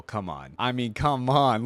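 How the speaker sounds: ordinary talk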